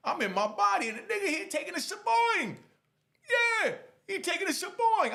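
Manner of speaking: high-pitched outraged outburst; furious. Retold or imitated. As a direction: voice shoots way up in incredulous fury, re-enacting it. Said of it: "I'm furious right now".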